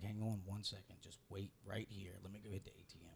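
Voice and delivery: low, soft retold request; hushed stalling. Man asks palm reader to wait for cash, low and soft. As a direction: quietly ask her to hold on.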